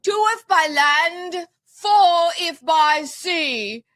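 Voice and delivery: high, drawn-out proclamation; mock-heroic declaiming. Host reciting the Revere signal, high mock-heroic declaiming.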